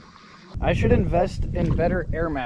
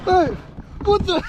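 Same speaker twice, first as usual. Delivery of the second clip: startled shout. Real, not acted. Hit by water.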